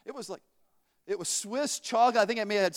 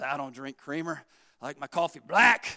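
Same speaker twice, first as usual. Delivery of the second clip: curt put-down; 7 semitones lower than usual. Retold or imitated. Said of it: "I don't know why she said it like that".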